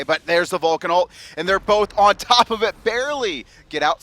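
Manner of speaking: loud, high-pitched caster peak; excited play-by-play. Real, not acted. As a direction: belt out the narrow escape as it happens. Live call of a narrow escape.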